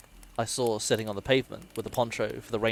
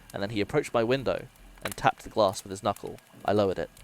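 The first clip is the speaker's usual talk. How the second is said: ordinary delivery, nothing marked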